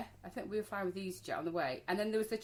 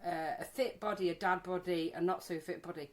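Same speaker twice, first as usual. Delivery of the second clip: ordinary talk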